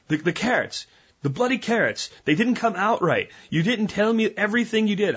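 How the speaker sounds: lightly hammed British friend grumbling; mock British complaint. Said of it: "cursing me in the polite British way".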